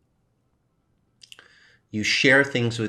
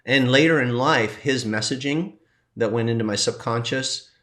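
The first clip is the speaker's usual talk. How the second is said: normal talking voice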